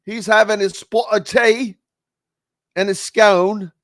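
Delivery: lightly mock a proper English gent; British accent. Radio host teasing an English caller, mock British accent. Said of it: "that's the only accent I can really do".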